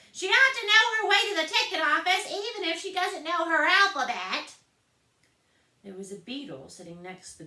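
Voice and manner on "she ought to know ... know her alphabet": very high, loud bleating goat; loud, much higher goat voice. A narrator's reading. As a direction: bleat it out loudly. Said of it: "said in a loud voice".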